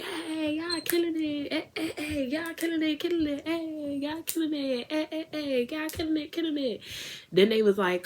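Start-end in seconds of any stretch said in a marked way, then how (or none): 0.0-6.8 high, excited cheering; squealing hype for the dancers. Retold or imitated. Hyping the dancers.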